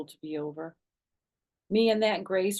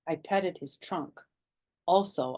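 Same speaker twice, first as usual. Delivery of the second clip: ordinary delivery, nothing marked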